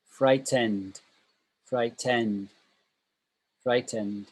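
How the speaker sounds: "frightened" with a clear final -ened, no -ing; contrasted word ending. Shown as a demonstration. Teacher contrasting two endings for students, careful "frightened" ending in d. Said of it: "As opposed to".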